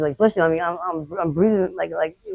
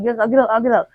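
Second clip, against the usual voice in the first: higher-pitched, giving in; retold frightened compliance; 6 semitones higher, 4 dB louder than usual. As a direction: re-enact hurried submission to a threat. Retells frightened compliance under threat.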